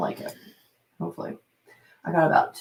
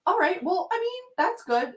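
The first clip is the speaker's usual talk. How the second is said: stranger's reply; 13 semitones higher than usual. Retold or imitated. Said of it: "And he was like".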